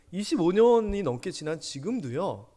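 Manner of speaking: ordinary talk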